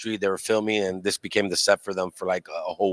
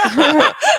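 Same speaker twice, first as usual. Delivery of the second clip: loud high laugh; amused laugh at himself; 9 dB louder than usual. Real, not acted. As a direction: burst out laughing. Laughs at being old.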